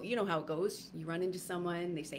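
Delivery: ordinary delivery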